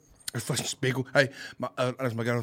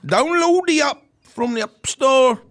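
loud, high, slow stab at Geordie; attempted Geordie accent; 9 semitones higher, 8 dB louder, slower than usual. Shown as a demonstration. Guest gives sponsor details on demand, attempted Geordie accent. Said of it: "in a Geordie accent".

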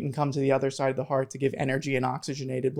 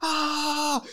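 much higher, shrieking with joy; ecstatic scream; 12 semitones higher, much slower than usual. Retold or imitated. Hearing he got into Jefferson, a high joyful shriek. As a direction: squeal out the good news like you can't believe it. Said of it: "I screamed".

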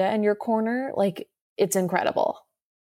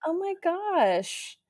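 slow, high oh my gosh; drawn out and overwhelmed; touched gasp; 8 semitones higher, 3 dB quieter, much slower than usual. Real, not acted. Touched by a listener's compliment, slow high gasp. Reacting to flattering review.

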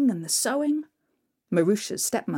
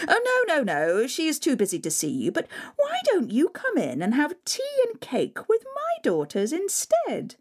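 high, sugary put-on politeness; fake-sweet stepmother; 7 semitones higher than usual. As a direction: sweeten it falsely for the guest. Stepmother sweet-talking suitors.

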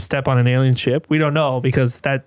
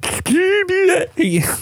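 high, silly alien gibber; squeaky put-on creature noise. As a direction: burble like a comic alien. Claims to be an alien.

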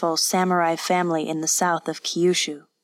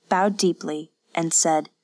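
ordinary delivery, nothing marked

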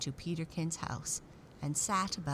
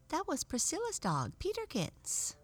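high, dainty doll voice; high French doll voice; 13 semitones higher than usual. Acted.

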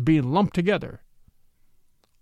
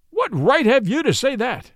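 indignant cry, pitched up high; righteously wrathful, voice jumping higher; outraged; 7 semitones higher than usual. A narrator's reading. Judge rebuking the witness for his remark, outraged. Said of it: "deeply outraged and righteously wrathful".

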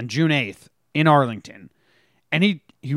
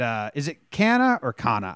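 normal talking voice

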